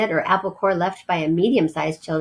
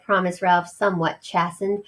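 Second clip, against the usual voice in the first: usual speaking voice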